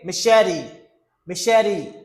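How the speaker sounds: "Machete" as mi-SHE-di; flat T. Shown as a demonstration. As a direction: say "Machete" with a flapped T. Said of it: "the T sounds like D".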